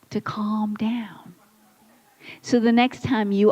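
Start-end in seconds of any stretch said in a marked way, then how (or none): 0.1-1.3 whispered, slow "to calm down"; soft slow whisper. Shown as a demonstration. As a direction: soothe the room with a whisper. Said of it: "I'm gonna tell you while you're all calm".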